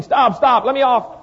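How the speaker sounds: hammed-up frightened plea to get off; kids screaming to stop. Kids spinning too fast beg to stop, mock panicked cry. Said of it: "But now they're screaming".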